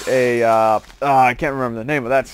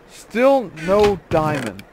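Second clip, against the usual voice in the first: slow, sung-out complaint, a touch higher; moaning still no diamond slowly; drawn-out lament; 4 semitones higher, much slower than usual. Real, not acted. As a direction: draw it out in mock sorrow.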